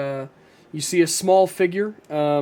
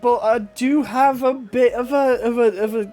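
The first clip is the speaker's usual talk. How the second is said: higher, hesitant dwarf smith; raised, accented shopkeeper voice, feeling her way; dwarvish woman; 5 semitones higher than usual. Acted. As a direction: offer help haltingly in a high dwarf voice. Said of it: "she says".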